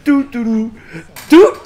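sing-song noises of happy skipping; happy doot-doot imitation. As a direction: mimic someone bouncing along merrily. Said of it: "he's all happy and shit".

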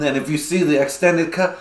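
ordinary delivery

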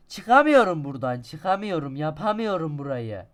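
loud exasperated complaint; loud frustrated moaning; frustrated whine. Real, not acted. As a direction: whine loudly that you're stuck.

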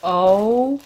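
long drawn-out, gasping amazement; overwhelmed awe. Real, not acted. Opening sissy's gift and finding it gorgeous, overwhelmed awe. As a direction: gasp and stretch it out, rising high in awe.

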